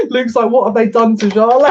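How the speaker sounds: laughing mock concern. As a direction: imitate a worried friend, bursting into laughter. Laughing while voicing friend's mock concern.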